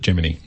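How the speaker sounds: "Gemini" with final -nee, not -nigh; Gemini pronounced "Jiminy". Shown as a demonstration. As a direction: Gemini ending in an "ee" sound. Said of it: "announcers who announced all those missions pronounced it".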